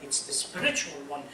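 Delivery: normal talking voice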